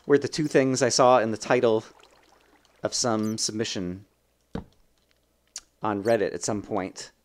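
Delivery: ordinary talk, nothing marked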